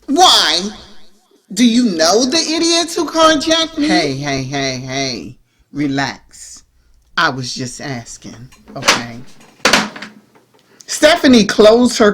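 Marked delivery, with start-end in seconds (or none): 0.1-3.9 defensive Nicole. A narrator's reading. Nicole snaps at Stephanie. 3.9-9.2 calming Stephanie. A narrator's reading. Stephanie calms Nicole down.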